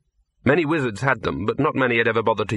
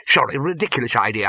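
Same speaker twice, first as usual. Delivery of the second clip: ordinary delivery, nothing marked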